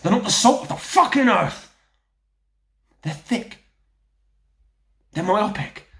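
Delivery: loud, rising rant, pitched high; scornful tirade. Acted. Telling Danny about the poor in this country, angry rant. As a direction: spit out each accusation, building in force.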